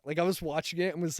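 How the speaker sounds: normal talking voice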